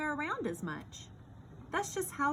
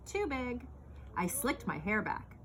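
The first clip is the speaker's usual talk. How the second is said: usual speaking voice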